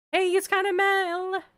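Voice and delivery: high, loud YouTube intro; YouTuber greeting. Real, not acted. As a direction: greet viewers like a vlogger. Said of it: "why did I say it like that?".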